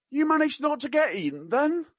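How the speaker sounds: very high mock-Cockney question; attempted Cockney. Quoting the prince to a student, in attempted Cockney. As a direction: pitch right up in would-be Cockney. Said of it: "I can't do Cockney. I will try my best".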